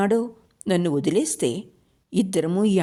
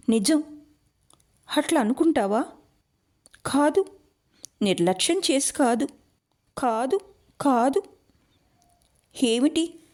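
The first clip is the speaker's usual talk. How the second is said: pleading; 7 semitones higher than usual. Acted. Pleading with Meera after a quarrel.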